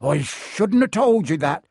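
alarmed self-reproach, stretched out; horrified blurt. A narrator's reading. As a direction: realise you let a secret slip. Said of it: "he blurted out".

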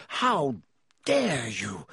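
restrained narrated shriek; shrieked outrage. A narrator's reading. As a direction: protest in indignant shock. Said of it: "Alicia shrieked".

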